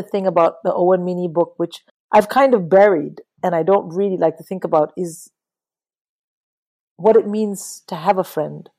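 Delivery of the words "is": usual speaking voice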